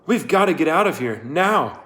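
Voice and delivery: fast, high hurried urging; urgent. A narrator's reading. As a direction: rush him out the door. Fleeing danger with Sam.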